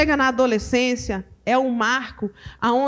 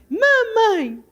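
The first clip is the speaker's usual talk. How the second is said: put-on high little-child voice saying its first word; baby's first word; 8 semitones higher than usual. Shown as a demonstration. Preacher imitating a baby's first word, high. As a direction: say it up high like a toddler. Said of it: "fala assim".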